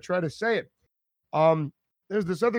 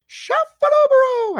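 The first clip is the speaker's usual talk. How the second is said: high falsetto, slow and loud; 7 dB louder than usual. Shown as a demonstration. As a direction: sing out the name in a high falsetto. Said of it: "I always say it like that".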